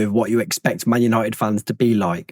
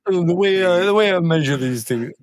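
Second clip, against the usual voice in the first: higher-pitched teasing parrot; mocking echo; 4 semitones higher than usual. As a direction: mimic your co-host's words mockingly. Mocking co-host's phrase back at him.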